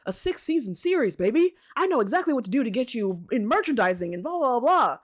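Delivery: high, loud huckster pitch; sleazy salesman. Acted. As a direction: oversell it like a slick agent. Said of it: "that salesman voice going on".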